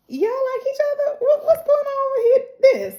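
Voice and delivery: high, rising playful tease; teasing excitement. Retold or imitated. Host teasing Elena and Coop, high and playful. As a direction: sing it out like gossip.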